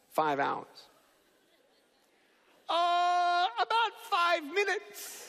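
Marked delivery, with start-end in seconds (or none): 2.7-5.3 hesitant principal. Retold or imitated. Principal answers how long he speaks.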